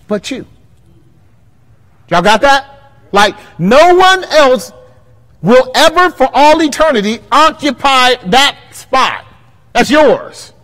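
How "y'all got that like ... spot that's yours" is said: loud, high preaching push; preaching at full force. Acted. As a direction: hammer it at the pews.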